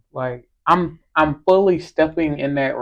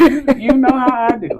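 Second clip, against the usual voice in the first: playful sing-song; 9 semitones higher, 7 dB louder than usual. Pretend. Playful repeat.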